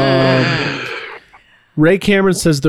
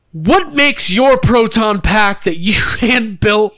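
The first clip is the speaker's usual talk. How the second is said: slow, higher whiny voice; whiny collector; 6 semitones higher than usual. Retold or imitated. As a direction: plead like an offended fan. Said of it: "people being like".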